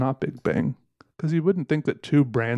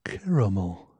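slow, savoring hum of a taster; mock coffee connoisseur; 3 semitones lower, slower than usual. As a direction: pretend to savor a sip thoughtfully. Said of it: "drank the coffee and thought".

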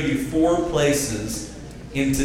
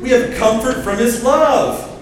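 higher, louder rousing preaching; preacher's call for amen; 9 semitones higher, 5 dB louder than usual. Shown as a demonstration. Preacher drawing an amen from church, loud and high. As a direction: pump it up for the congregation to answer. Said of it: "a preacher like Paul could say it like this".

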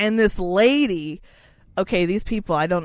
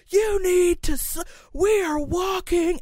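mock old man; 9 semitones higher than usual. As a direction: imitate the pushy old man leaning in. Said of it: "he's like".

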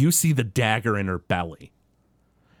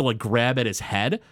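ordinary delivery

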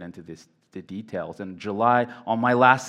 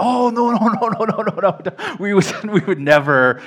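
much higher and louder, laughing through the no's; a flustered laughing protest of no, no, no; laughing denial; 10 semitones higher, 7 dB louder than usual. Retold or imitated. Hurried denial to the old man.

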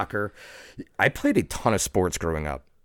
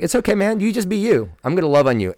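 higher, gentle reassurance quoting a kind mentor; loving mentor; 12 semitones higher, 6 dB louder, faster than usual. Retold or imitated. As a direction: reassure him lovingly, pitched up. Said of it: "And he was like".

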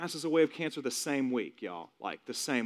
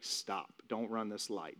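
soft, low caution; inner stop; 4 semitones lower, 9 dB quieter, slower than usual. Retold or imitated. Inner voice warning son not to go on, quiet stop. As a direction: hold someone back with quiet firmness. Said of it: "It's like an inner stop".

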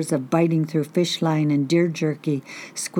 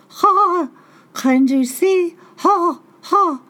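high, loud cawing bird voice; shrill trickster bird calling out; Raven voice; 13 semitones higher, 5 dB louder, slower than usual. Acted. Raven calls from the fog.

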